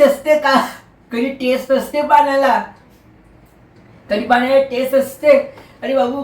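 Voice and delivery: acted annoyance, voice held high; indignant scolding. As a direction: challenge a silly question in disbelief. Scolds man asking about water's taste.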